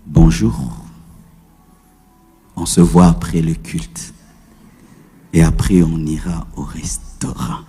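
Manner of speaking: very low, soft and whispered, like to a sweetheart; tender lover's whisper. Shown as a demonstration. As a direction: murmur it tenderly to someone you love. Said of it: "Mais quand c'est quelqu'un que tu aimes". (chuchotement tendre et grave, comme à l'être aimé)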